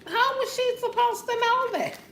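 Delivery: very high, squealed what the fuck; shocked outburst. Retold or imitated. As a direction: shriek in high disbelief. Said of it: "I said".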